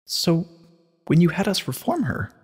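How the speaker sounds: put-on high, breathy young mare voice, shaken; Twilight, shaken. A narrator's reading. Twilight asks Celestia about Luna, stunned and high. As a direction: ask it pale and stunned. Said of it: "Twilight was pale".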